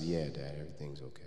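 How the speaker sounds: quiet reply. Retold or imitated. Son quietly plays it off to his dad. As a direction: mumble it to hide the hurt.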